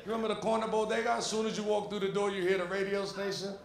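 ordinary delivery

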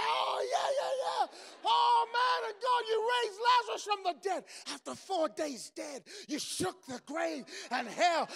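joining a friend's prayer at full voice; shouting praise. Retold or imitated. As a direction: shout out the praise in rising fervor.

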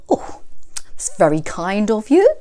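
lightly voiced smiling wolf; a hint of a sly grin. A narrator's reading. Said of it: "smiled the bad, bad wolf".

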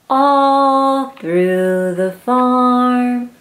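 drawn out slowly; slow refrain. Acted. Reading to a child, slow sing-song refrain. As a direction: stretch each word out.

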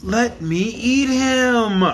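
little dragon begging to eat the monkey; pleading. Acted. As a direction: beg like a greedy little child. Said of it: "pleaded the little dragon".

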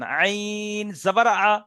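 each letter and vowel drawn out slowly; slow spelling out. Shown as a demonstration. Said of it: "এর পুরো বানান Ezra করবেন". (ধীরে ধীরে বানান করে পড়া)

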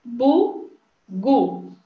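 BUGU as two separate beats, Bu, gu; syllable by syllable. Shown as a demonstration. Teacher counting syllables with children, Bugu split in two. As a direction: say "Bugu" with a clear gap after Bu. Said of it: "quantas vezes eu abri a boca".

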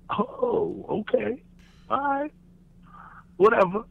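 high, soft, wounded okay; mock hurt. Told to leave the apartment, answering high and soft.

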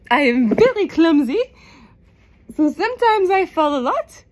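ordinary talk, nothing marked

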